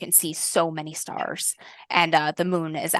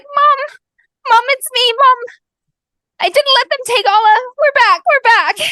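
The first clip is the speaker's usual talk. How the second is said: calling out to mom; 13 dB louder than usual. Acted. Girl calling to her mother.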